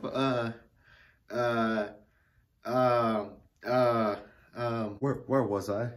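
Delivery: usual speaking voice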